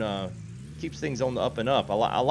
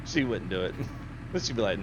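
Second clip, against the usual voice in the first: ordinary talk, nothing marked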